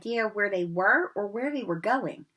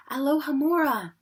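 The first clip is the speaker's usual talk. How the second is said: ordinary delivery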